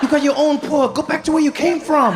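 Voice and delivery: high-pitched mock indignant rebuke; hammed-up resentful local; acted indignation. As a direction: tell off an intruder, pitched high. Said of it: "He's like".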